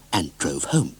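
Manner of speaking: ordinary delivery, nothing marked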